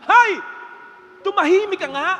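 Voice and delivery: loud, high irritated shushing; annoyed scolding. Acted. Bystander telling the drowning woman to shut up, loud irritated scold. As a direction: snap back at her, fed up.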